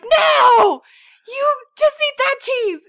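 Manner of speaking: high, loud reenacted refusal; exasperated shout. Mom refuses son a second cheese, retold exasperated shout. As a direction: shout it down, at the end of patience.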